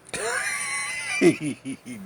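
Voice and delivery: high, loud cackling laugh; shrieking laugh. Real, not acted. Teller cackling at his own warning.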